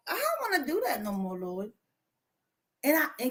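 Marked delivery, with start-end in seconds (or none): 0.1-1.7 much softer, faster complaint to the Lord that fades away; hushed, half-hearted protest; mock whiny complaint. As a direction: whine it under your breath. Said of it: "and told the Lord, like".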